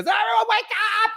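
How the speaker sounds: very high, shrill-free squeaky mock yelling; mock panicked yell. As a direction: ham up a frantic wake-up call. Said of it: "He goes".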